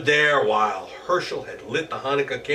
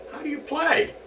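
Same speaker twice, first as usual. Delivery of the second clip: high eager goblin. Acted. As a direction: ask greedily like the goblin.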